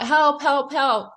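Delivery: high, loud mock yell for help; retold cry for help. Man in a pit yelling to passersby, high mock yell. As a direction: call out from the bottom of the pit. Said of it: "he was yelling out".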